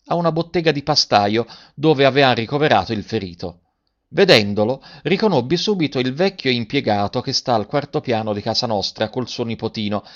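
plain reading voice

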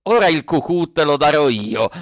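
a loud, angry threat; shouted threat. A narrator's reading. The boldest boy threatening Pinocchio, a loud angry shout. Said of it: "gridò il più ardito".

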